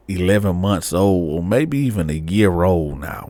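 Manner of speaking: ordinary delivery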